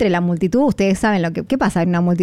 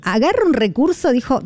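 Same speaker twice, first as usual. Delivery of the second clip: normal talking voice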